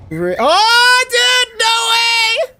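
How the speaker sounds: high, slow, drawn-out yell of disbelief; excited shout. Real, not acted. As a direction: shout it out in stunned joy. Stunned by a win on stream.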